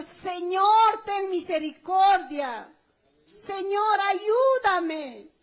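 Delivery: loud, high plea to God; crying out. Retold or imitated. Believer begging the Lord in affliction, crying out. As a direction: cry out urgently for help. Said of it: "hemos clamado".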